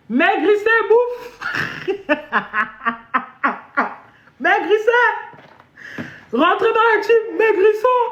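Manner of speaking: rally the troops, half laughing; high mock rallying cry. Mock slogan for weight loss. (cri de ralliement moqueur et aigu)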